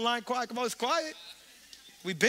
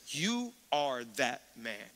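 slow, low and soft accusation; low, slow, hushed verdict; grave accusation; 8 semitones lower, 5 dB quieter, slower than usual. Retold or imitated. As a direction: point the finger and say it slowly. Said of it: "points in it at his face and says".

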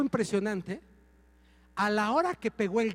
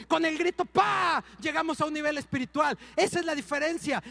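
más fuerte, con golpes ¡pum!; predicación enérgica; 4 semitones higher than usual. Acted. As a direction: predicar encendido. (predicación encendida, fuerte)

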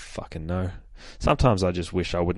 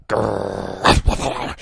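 dog growl; 4 dB louder than usual. Retold or imitated. Dog growls before charging in.

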